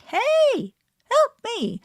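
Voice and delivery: put-on very high, loud baby cry for help; voicing a colicky baby in distress, very high and loud; baby begging for help. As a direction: call out like a baby in pain.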